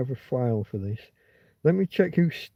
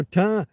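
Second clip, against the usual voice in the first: "ta-" pushed hard and higher; stressed first syllable; 6 semitones higher than usual. Shown as a demonstration. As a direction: press down on the opening "ta-". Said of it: "I pressed down at the beginning".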